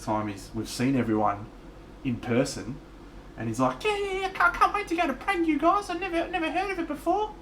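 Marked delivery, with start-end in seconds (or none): 3.8-7.3 very high, excited mate's impression; high-pitched goofy mate voice; the Blythe voice. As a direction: squeak it out like a clueless, delighted bloke. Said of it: "The Blythe voice".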